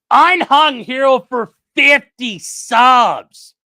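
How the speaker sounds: loud, slow hype call; hype announcer. Real, not acted. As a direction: drag it out like a ring announcer. Announcing a big donation.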